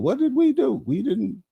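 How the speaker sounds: high, put-upon mocking whine; mock whiny voice. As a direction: squeak it out like a mock victim. Mocking what Haitians would say.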